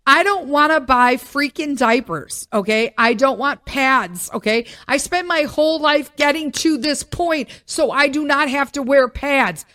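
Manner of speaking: refusing outright and letting it ring; loud emphatic rant. Real, not acted. To the women watching, about diapers, a loud firm rant.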